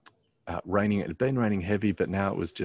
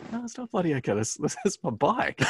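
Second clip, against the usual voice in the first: high-pitched, exasperated retort, half laughing; indignant protest; 11 semitones higher than usual. Retold or imitated. Retelling his answer to the bike thief, indignant protest. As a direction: protest in comic outrage, voice shooting up.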